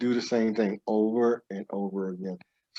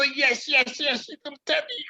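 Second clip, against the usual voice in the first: much higher, breathless eager voice that fades into a whisper; excited higher self. Acted. Eager higher self answering a promise to meditate, high and whispering. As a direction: squeal it like an overjoyed inner voice. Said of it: "your higher self gets so excited".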